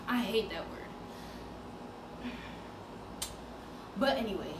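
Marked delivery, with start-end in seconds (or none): 0.1-2.3 hushed aside. Acted.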